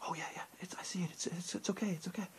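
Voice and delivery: put-on calm the kid down under your breath, embarrassed; hushed embarrassed whisper. Mom hushing her excited son in the aisle, embarrassed whisper.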